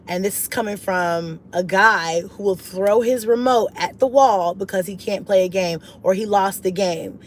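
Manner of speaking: ordinary delivery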